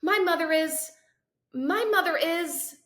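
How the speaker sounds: high, loud, then slowing as memory fails; faltering panic. Acted. Boy tries to name his mother and stalls, high and loud. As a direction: start strong then grope, lost.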